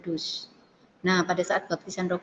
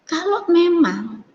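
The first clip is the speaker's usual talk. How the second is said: high, slow, drawn-out plea; retold inner cry; 8 semitones higher, much slower than usual. Woman challenging God in prayer, high retold cry. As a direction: imitate pleading aloud without shouting. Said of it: "kayak seperti teriak gini".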